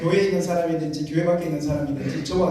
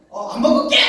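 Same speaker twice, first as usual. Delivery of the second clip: loud, higher snappy Gyeongsang refusal; mock fight shout; 5 semitones higher, 8 dB louder, faster than usual. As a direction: fire it back like a family squabble. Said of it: "평상시 말이 다 싸우는 말이에요". (크게 쏘아붙이는 경상도 말투)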